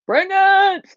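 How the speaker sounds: high, loud battle cry; shouted challenge. Retold or imitated. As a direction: yell it like daring all challengers. Acted challenge to her doubters.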